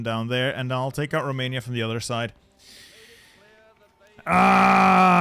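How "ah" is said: loud cry of dismay at his blunder; a pained yell, louder; anguished shout. Real, not acted. Realises he messed up.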